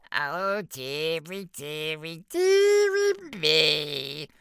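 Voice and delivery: high, drawn-out prissy imp voice; tut-tut like a pompous little official; fussy officious imp. Acted. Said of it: "tutted officiously".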